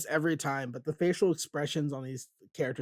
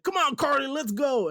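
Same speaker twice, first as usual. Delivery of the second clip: much higher, urgent dad shout; acted urgent call; 9 semitones higher than usual. Host voices dad grabbing Carly to flee, acted urgency. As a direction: hurry her along in a raised, put-on voice.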